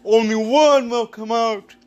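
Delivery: booming wrestling announcer promo; big ring announcer hype voice; dramatic reality show hype. Acted. Wrestling event promo intro.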